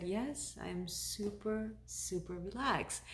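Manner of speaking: soft, drawn-out, blissed-out reply; super relaxed. Retold or imitated. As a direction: answer softly, stretching out the word super. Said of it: "You're so relaxed".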